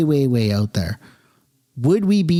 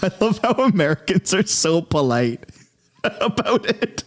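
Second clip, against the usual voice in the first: higher voice breaking into laughter; laughing; 7 semitones higher than usual. Real, not acted. Streamer tickled by the polite American threat, laughing. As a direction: talk through a real laugh.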